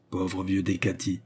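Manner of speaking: usual speaking voice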